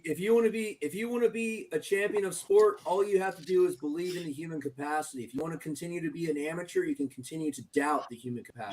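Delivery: preachy guru lecture. Acted. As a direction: lecture them like a wise guru. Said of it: "I hate when he talks like that".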